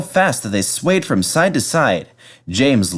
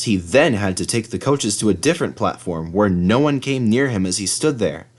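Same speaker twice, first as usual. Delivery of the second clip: plain reading voice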